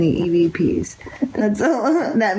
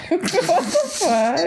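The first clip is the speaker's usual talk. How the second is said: laughing; 8 semitones higher, slower than usual. Real, not acted. Laughing at a cold presence.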